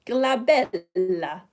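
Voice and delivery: drawn-out mock-Italian "glabella"; Italian accent. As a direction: roll out "glabella" slowly, Italian style. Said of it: "say it in Italian accent".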